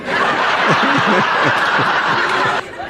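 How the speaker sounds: loud breathy laugh that tails off; burst of laughter. Acted. Younger brother at Del's joke, loud breathy laugh.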